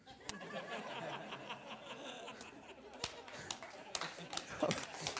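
long quiet chuckle at his own joke; a soft drawn-out laugh. Real, not acted. Preacher laughing at his own punchline, soft chuckle.

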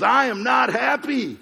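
high, loud, drawn-out mock yell; comic high-pitched angry outburst; mad yelling dwarf. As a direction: imitate a furious little dwarf, each word stretched. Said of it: "he was all mad, yelling".

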